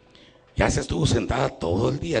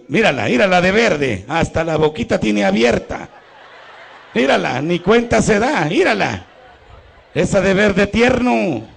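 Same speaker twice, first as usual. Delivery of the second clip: loud, high playful teasing; 8 semitones higher, 4 dB louder than usual. Real, not acted. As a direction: tease the sleeping woman. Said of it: "teasing dozing woman in congregation".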